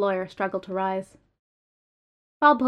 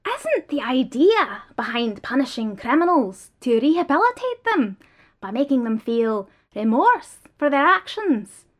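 desperate protest; 6 semitones higher, 6 dB louder than usual. Acted. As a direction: fight the verdict with rising despair. Restrained prisoner protests innocence.

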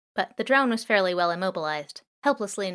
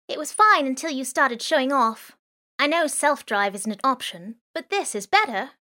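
put-on raised, higher girl voice, chiding her partner; punk girl scolding; 6 semitones higher, 5 dB louder than usual. Girl to boy after the crash, chiding raised voice. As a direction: scold him for showing off.

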